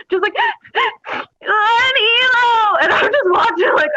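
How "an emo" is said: gasp, then a high drawn-out wail; hammed-up sobbing yell; mock bawling. Bawling recruit forced to yell at the chow line, mock sobbing. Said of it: "she's in a full bawling episode".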